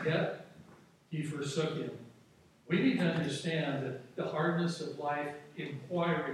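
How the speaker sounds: ordinary talk, nothing marked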